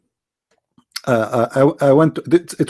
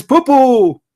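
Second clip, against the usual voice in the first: higher mock crowd chant; imitating church crowd; 6 semitones higher than usual. Speaker imitating the church crowd chanting back at him, higher mock chant. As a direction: echo it back like a whole congregation repeating him. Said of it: "Everybody in the church says".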